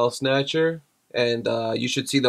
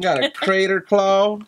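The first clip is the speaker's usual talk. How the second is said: put-on high-pitched silly Southern accent; mock Southern accent; 6 semitones higher than usual. Husband amusing his wife, high mock Southern accent. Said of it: "If you're Southern and talk like this".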